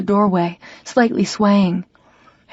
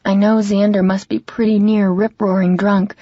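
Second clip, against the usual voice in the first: plain reading voice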